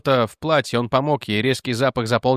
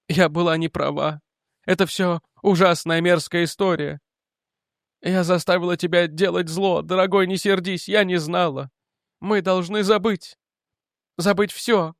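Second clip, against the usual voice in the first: higher, pleading woman's voice; mother pleading with her angry son; weak remorseful mother; 6 semitones higher than usual. Acted. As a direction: beg him gently to forget.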